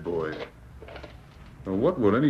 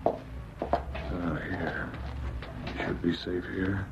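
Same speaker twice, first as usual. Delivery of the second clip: hushed; 3 semitones lower, 6 dB quieter, slower than usual. Acted. Hiding from the trappers.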